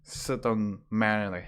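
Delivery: ordinary talk, nothing marked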